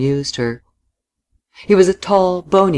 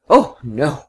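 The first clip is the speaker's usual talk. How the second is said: a touch of a full-throated cry. A narrator's reading. The woman protesting that she hasn't found out all she wants, crying out. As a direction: cry it out from the chest. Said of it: "she cried with full throat".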